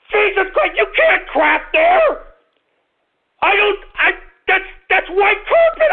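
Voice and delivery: furious yelling. Acted. Radio host yelling at his dog for crapping on carpet, furious shout. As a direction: lose it completely over the mess.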